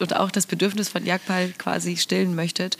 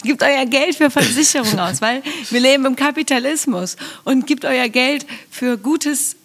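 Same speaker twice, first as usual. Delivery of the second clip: mock ad pitch; 7 semitones higher than usual. Mock ad urging to buy insurance.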